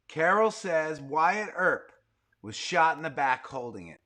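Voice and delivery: usual speaking voice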